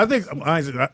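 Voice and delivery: ordinary talk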